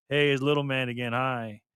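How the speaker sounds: ordinary delivery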